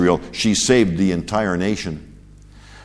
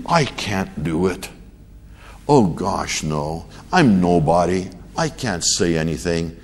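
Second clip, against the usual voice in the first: mock false humility; 5 semitones lower, 4 dB quieter than usual. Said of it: "That's a false sense of humility".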